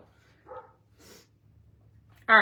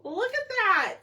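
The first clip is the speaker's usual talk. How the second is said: much higher and louder, thrilled; excited gushing; 12 semitones higher, 8 dB louder than usual. Real, not acted. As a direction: show it off like it's the cutest thing. Showing off the watermelon piece.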